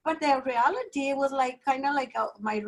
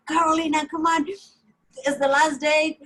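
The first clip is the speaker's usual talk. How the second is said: raised, louder urging of a friend; coaxing; 5 semitones higher, 4 dB louder than usual. Retold or imitated. Speaker urging Carolina to play on the last day, coaxing. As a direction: urge a reluctant friend along.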